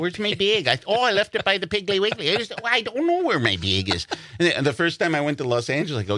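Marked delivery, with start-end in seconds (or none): 0.0-0.7 high Wisconsin "bag", nearly "beg"; Wisconsin accent. Acted. Local hunting for a bag, hammed-up Wisconsin accent. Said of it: "We say bag". 0.9-4.1 high, fretting Wisconsin local; Wisconsin accent. Acted. Local who lost a bag, hammed-up Wisconsin accent. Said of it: "We say bag".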